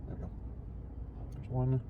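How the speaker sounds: ordinary delivery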